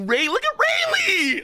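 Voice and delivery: real fan shout; excited shout. Real, not acted. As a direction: yell it out in awe. Hyped reaction to panel.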